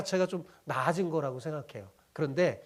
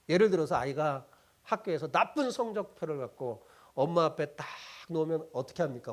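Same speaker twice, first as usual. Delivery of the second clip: usual speaking voice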